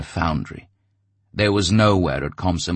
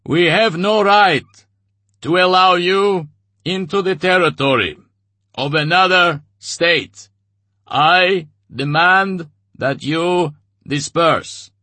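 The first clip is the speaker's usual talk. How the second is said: much higher, louder, slow official pronouncement; booming refusal; 13 semitones higher, 8 dB louder than usual. A narrator's reading. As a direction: lay down the law, slowly. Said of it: "in a booming voice".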